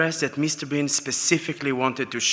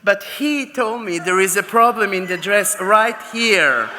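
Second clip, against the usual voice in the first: higher-pitched, comically indignant protest; protesting model; 6 semitones higher, 4 dB louder than usual. Retold or imitated. Model answering the designer in front of editors, protesting. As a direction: imitate her innocently giving the secret away. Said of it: "you know what she said?".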